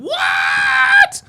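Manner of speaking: a high, shouted what; shocked what. Real, not acted. Streamer shocked by a chat message, shouting in disbelief. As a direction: cry out in disbelief. Streamer reacts to viewer comment.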